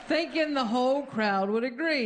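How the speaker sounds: usual speaking voice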